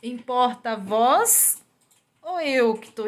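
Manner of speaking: slow, loud, stern voice of God; a loud slow rebuke from God. Retold or imitated. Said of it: "Deus falou assim". (voz de Deus, lenta e severa)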